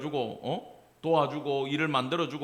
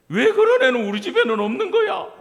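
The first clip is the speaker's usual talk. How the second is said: laughing lament; 11 dB louder than usual. Retold or imitated. Jokingly laments own kids.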